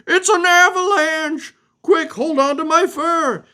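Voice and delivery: high, loud, urgent alarm; panicked warning. Acted. Dog warns Pebbles of the avalanche, high and urgent. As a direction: shout the danger with drawn-out words.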